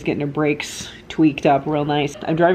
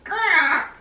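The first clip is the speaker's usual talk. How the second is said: frustrated cry; 12 semitones higher than usual. Real, not acted. Frustrated outburst.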